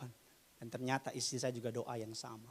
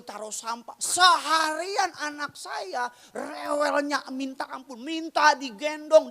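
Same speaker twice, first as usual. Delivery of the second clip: normal talking voice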